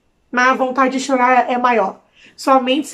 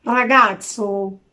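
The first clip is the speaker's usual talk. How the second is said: mock Italian; 3 semitones lower, much slower than usual. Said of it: "italiano falando italiano".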